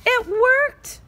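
triumphant shout; excited victory cry; joyful shout. Acted. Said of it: "shouted Highlighter".

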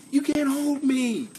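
high, slow comic insistence; hammed-up defiance. Streamer telling viewers he won't be boxed in, hammed-up defiance. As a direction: stretch it out in a high put-on voice. Repeats defiant line before big shout.

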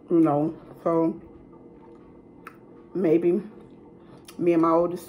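talking with mouth full; eating between words; chewing fries while talking. Real, not acted. Seen 2: Fries held and put in mouth repeatedly while she talks.

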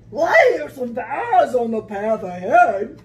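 loud monster voice; booming Gruffalo. Acted. Gruffalo warning of a hoot ahead, loud and booming. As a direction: announce it in a big monster boom.

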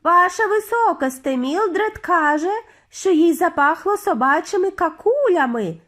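urgent high report; calling out. A narrator's reading. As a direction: call up to the head witch. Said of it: "вигукнув хтось зі".

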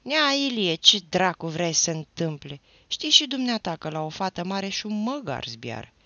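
loud bitter complaint bursting out; an angry young man venting his heartbreak; sudden outburst. A narrator's reading. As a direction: let it all burst out at once. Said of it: "deodată izbucni".

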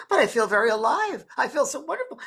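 high imitated lady, protesting; Scottish lady. Spirit lady insisting to her sister, high imitated voice. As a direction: protest in a put-on high woman's voice. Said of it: "a lady from Scotland".

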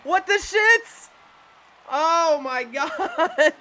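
high, loud cry of amazement through laughter; real high-pitched laughing outburst; laughing disbelief. Real, not acted. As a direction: crack up in shock, stretching the words out. Reaction to a stunning play.

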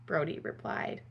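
plain reading voice

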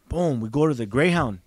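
ordinary talk, nothing marked

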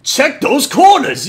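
lightly voiced army captain, drawn out; Captain Price impression. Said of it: "I probably sound just like him".

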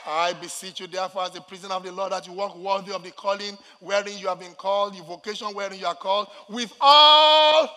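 steady chant-like reading bursting into a shout; scripture declamation. Real, not acted. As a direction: read the verse out, then belt the last words.

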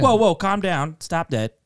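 high, loud call to settle down; mock parental scolding. Dad telling his yelling son to settle, mock scolding.

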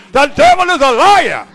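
loud, high, driving preacher's call; full-force preaching. Acted. Preacher urging husbands, at full force. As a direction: preach it hard to the congregation.

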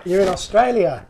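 ordinary delivery

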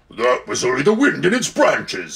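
stubborn warthog voice; snorting warthog. A narrator's reading. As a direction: grunt like a warthog. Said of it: "said the warthog".

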